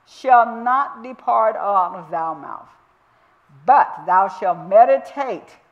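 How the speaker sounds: slow, measured reading of the verse; slow scripture reading. Shown as a demonstration. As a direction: lay each word of the verse down slowly. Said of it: "And that word meditate means to murmur".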